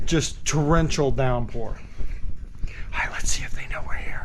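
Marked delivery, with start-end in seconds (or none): 1.7-4.3 hushed whisper. Real, not acted. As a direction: whisper so the dogs don't hear. Sneaking in to see pets.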